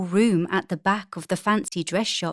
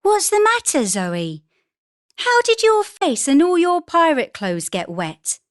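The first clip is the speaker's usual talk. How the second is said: worried mother questioning; surprised mother; 9 semitones higher than usual. A narrator's reading. Mother voiced in story.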